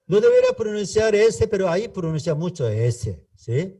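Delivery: usual speaking voice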